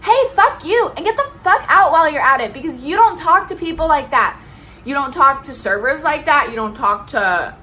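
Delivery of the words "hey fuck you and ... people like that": much higher and louder outburst telling him off; imagined shout. Pretend. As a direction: blast him on the server's behalf. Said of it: "I'd be like".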